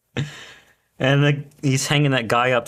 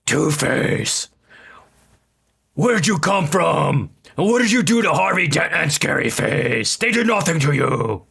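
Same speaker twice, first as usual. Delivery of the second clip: a hint of Batman, slowed and higher at the start; lightly voiced Batman growl-less quote; 5 semitones higher, 3 dB louder than usual. Friend retelling the Batman scene, mock Batman voice. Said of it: "Batman's like".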